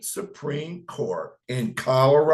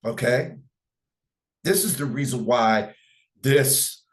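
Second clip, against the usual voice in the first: usual speaking voice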